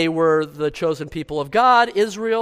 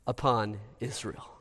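ordinary talk

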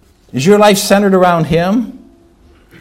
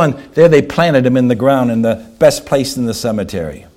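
ordinary talk

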